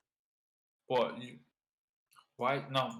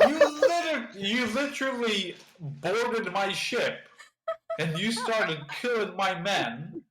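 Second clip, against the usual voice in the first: affronted captain, higher, half laughing; a wronged captain scolding the intruders; indignant captain; 7 semitones higher than usual. Acted. Boarded captain accusing the pirates, indignant.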